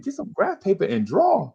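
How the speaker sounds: high-pitched, disbelieving retold echo; retold schoolkid disbelief; incredulous echo. Pupil echoing teacher in disbelief.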